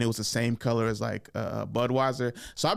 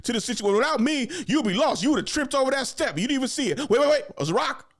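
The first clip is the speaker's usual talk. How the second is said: higher, louder bossy coaching; 10 semitones higher, 3 dB louder, faster than usual. Retold or imitated. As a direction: boss them around like a lazy supervisor. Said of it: "that person coaching you".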